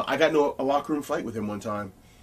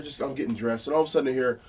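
usual speaking voice

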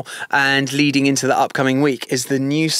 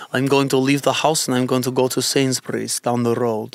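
Russian accent; 3 semitones lower than usual. Shown as a demonstration. Host demonstrating a Russian accent for partner to copy, Russian accent. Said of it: "if I was to do a Russian accent".

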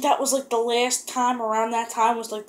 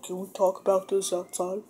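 hushed serious; 2 semitones lower, 4 dB quieter than usual. Retold or imitated. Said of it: "like, it's, like, real serious".

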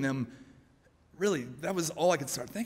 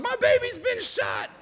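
hammed-up high, slow wail of a mother; frantic mother; slower than usual. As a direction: cry out in mock maternal panic. Acts frantic mother running in.

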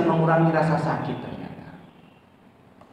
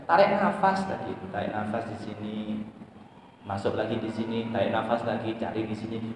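soft breathing guidance; 4 semitones lower, 8 dB quieter than usual. Shown as a demonstration. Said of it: "guiding breathing".

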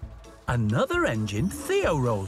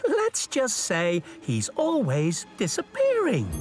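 higher, lightly amused engine voice; chuckling character; 6 semitones higher than usual. A narrator's reading. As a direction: share a little joke as Lexi. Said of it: "Lexi chuckled".

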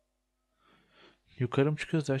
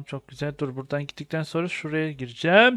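ordinary delivery

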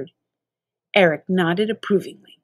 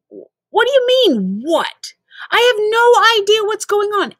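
upset protest; 11 semitones higher, 5 dB louder than usual. Acted.

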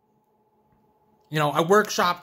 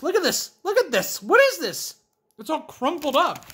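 incredulous; 10 semitones higher, 4 dB louder than usual. Real, not acted. Shocked at crumbled chips.

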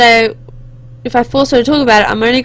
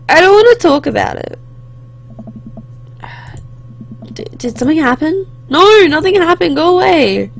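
put-on upset teenage daughter; 6 semitones higher than usual. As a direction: snap back at dad to be left alone. Upset daughter voiced by player.

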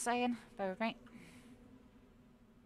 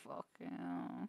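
much lower, softer and slow; low groaned curse; 11 dB quieter, much slower than usual. Real, not acted. Streamer exhausted from laughing, low slow groan.

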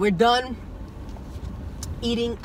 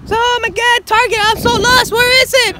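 way up high and loud; exasperated, high and loud; 6 dB louder than usual. Real, not acted. Lost, exclaiming.

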